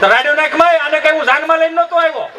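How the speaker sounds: acted domestic squabble; quarrelling husband. Husband quarrels back at wife.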